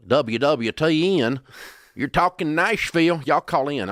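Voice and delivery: loud, high-pitched hillbilly radio host; exaggerated Southern hillbilly on-air voice; heavy hillbilly twang. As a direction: belt out a call-in plug in hillbilly twang. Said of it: "in a really heavy hillbilly twang".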